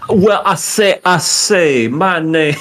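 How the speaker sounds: high, loud Southern drawl; Southern gentleman. Pretend. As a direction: play a grand Southern gent. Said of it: "Slick my hair back and go".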